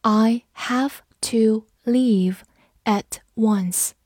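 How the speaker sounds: slow, evenly separated words; word-by-word teacher's model sentence; slow reading. Shown as a demonstration. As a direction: read it slowly, pausing between words. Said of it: "我们慢读一遍".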